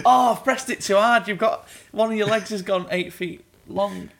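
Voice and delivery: mock alarmed doctor.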